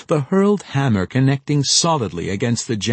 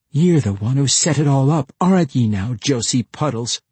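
higher, indignant accusation; accusing; 4 semitones higher, 4 dB louder than usual. A narrator's reading. Deli blaming Josie for Wolfgar, accusing. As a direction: throw the blame straight at him. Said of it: "she accused".

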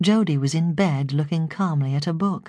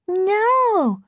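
put-on very high-pitched toddler refusal; heightened baby voice; 3 dB louder than usual. A narrator's reading. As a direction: insist in an exaggerated baby voice. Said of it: "heightening her baby voice".

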